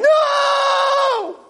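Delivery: very high, drawn-out scream; retold scream. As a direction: scream "no" up from the earth.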